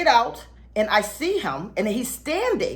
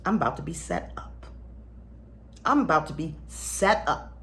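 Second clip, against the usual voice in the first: low, hushed realization; quiet inner voice, lower and softer; thinking to herself; 5 semitones lower, 6 dB quieter than usual. Retold or imitated. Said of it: "I'm thinking to myself".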